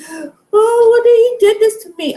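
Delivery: high, loud whiny mocking voice; mock helpless victim. Mocking passive victims, high whiny put-on voice. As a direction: mimic a helpless woman complaining. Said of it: "I'm not gonna be one of these".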